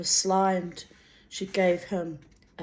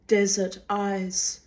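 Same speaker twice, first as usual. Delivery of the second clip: plain reading voice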